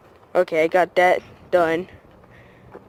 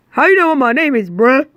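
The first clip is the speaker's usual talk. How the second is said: higher, louder startled question; surprised dog; 6 semitones higher, 4 dB louder, faster than usual. Acted. Dog shocked the owner knows its name, startled. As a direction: jump back in disbelief.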